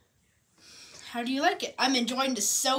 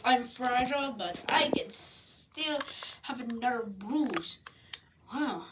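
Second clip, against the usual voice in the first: usual speaking voice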